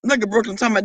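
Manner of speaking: ordinary talk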